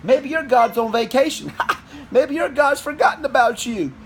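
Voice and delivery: mocking. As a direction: needle him like a schoolyard taunt. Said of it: "Elijah picked on him".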